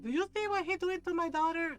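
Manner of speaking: rally the neighbor in outrage; outraged mom. Retold or imitated. Mother calling out to the neighbor about her daughter, loud and outraged.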